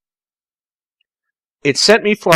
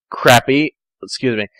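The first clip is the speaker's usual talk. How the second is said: usual speaking voice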